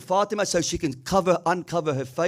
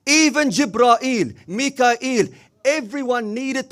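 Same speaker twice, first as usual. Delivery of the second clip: rising emphasis; 5 semitones higher, 4 dB louder, slower than usual. Acted. Preacher building to a climax.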